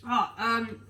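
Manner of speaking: normal talking voice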